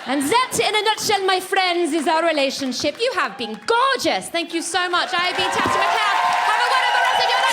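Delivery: loud, high farewell over applause; comedian's triumphant show closer; rousing sign-off. Real, not acted. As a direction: project a big thank-you to the whole crowd. Signing off to the applauding crowd.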